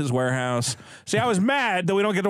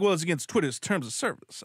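mocking imitation; 4 dB quieter than usual. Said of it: "All the time he's like".

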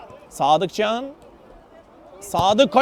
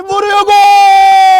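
slow loud build to goal; screaming football commentator; 3 semitones higher, 5 dB louder, much slower than usual. Real, not acted. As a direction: build and explode on gol. Goal call in live commentary. (bağıran maç spikeri)

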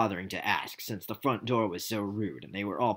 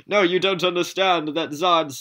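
loud Zod voice; 8 semitones higher, 9 dB louder than usual. Acted.

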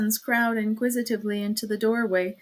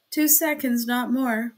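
ordinary delivery

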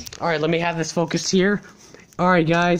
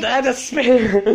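slow, high words through a laugh; laughing at the spare; 6 semitones higher, 5 dB louder, much slower than usual. Real, not acted. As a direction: laugh it out slowly. Laughing at the bowling result.

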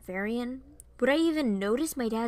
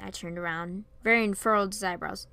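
ordinary delivery

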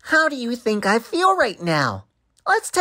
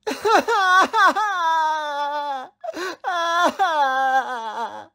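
long, higher-pitched bawling sobs; loud childish wailing; 5 semitones higher than usual. Acted. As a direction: bawl like a heartbroken child. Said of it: "because I'm crying".

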